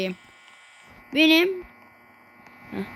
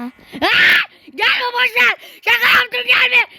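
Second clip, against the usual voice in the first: high, loud frightened yelling, slowing down; panicked shout; 10 semitones higher, 7 dB louder, much slower than usual. Real, not acted. Gamer begging the monster to stay away, screaming. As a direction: yell in terror, begging it off. Takes back calling monster over.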